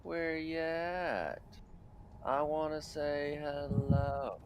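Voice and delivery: high silly voice. Pretend. Greeting in a put-on voice.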